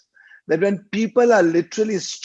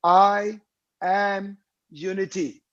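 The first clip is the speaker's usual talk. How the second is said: "I ... am unity", each part set apart by pauses; word split apart; 2 semitones higher, slower than usual. Shown as a demonstration. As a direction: break it up slowly: "I", pause, "am unity". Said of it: "immunity is nothing but".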